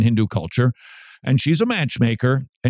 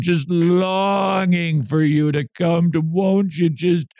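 higher, drawn-out mock begging; mock longing, stretched and pitched up; sissified boyfriend pleading; 5 semitones higher, slower than usual. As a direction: plead like a lovesick, wimpy boyfriend. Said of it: "present him as a sissified boyfriend".

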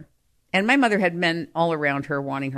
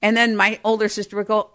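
normal talking voice

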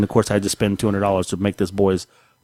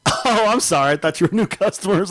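clerk voice; 8 semitones higher than usual. Retold or imitated. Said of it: "She goes".

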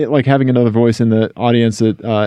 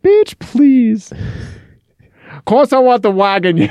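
put-on awful over-the-top Boston accent; 11 semitones higher than usual. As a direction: camp it up in a bad Boston accent. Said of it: "me doing an awful B-, Boston accent".

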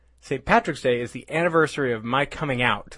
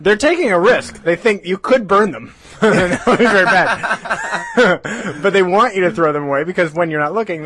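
laughing while talking; 4 semitones higher, 6 dB louder than usual. Real, not acted. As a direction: giggle through the words. Laughing through his joke.

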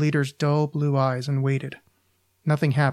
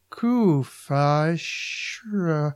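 garbled speech noises; much slower than usual. A narrator's reading. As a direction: imitate unintelligible speech. Girlfriend's words come through unintelligible.

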